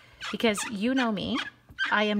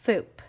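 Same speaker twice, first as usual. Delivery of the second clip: a short, louder "foop" sound effect; playful stamping foop noise; 5 dB louder than usual. Shown as a demonstration. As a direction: pop out a cartoon foop. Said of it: "Make that noise".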